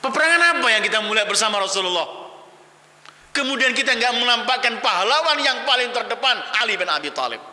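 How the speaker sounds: high, forceful sermon delivery; impassioned preaching. Acted. (khotbah berapi-api)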